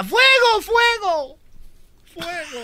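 high shouted cry, fading away; yelling fire. Retold or imitated. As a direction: shout it like the order to shoot. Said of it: "Y grita la güerita".

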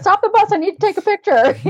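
high, loud pleading yell; mock scream. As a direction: beg the driver to stop. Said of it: "wanted to scream that".

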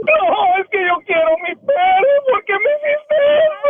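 llanto real. Real, not acted. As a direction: cry it out, stretching the final words. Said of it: "Ya no se está llorando". (sollozo que sube a lamento agudo)

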